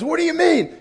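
high, loud burst of disbelief; exasperated demand. Retold or imitated. Sheikh reacting to a withheld secret, loud exasperated demand. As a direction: imitate an impatient man demanding an answer.

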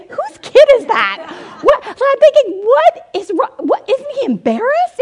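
high, loud incredulous outburst; mock appalled disbelief. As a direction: squeal it as a scandalized mother. Mother's appalled thoughts, acted out.

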